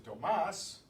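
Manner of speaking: "Tomás" with the final syllable stressed; stress on last syllable. Shown as a demonstration. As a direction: lean on the MÁS of "Tomás". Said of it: "the stress is on the last syllable".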